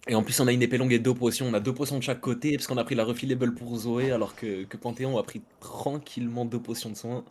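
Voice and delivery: esports caster commentary; play-by-play. Acted. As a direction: call the match live, steady pace. Casts the match live.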